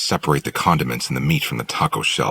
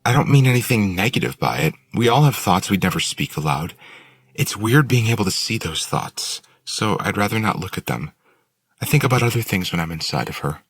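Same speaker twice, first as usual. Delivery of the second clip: put-on higher, louder young woman voice; Willow; 8 semitones higher, 4 dB louder than usual. A narrator's reading. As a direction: reassure him in a girl's voice. Willow explains, held character voice.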